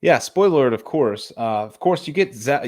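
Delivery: normal talking voice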